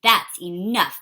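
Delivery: mother putting her foot down; yelled. Acted. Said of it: "Missus Brains yelled".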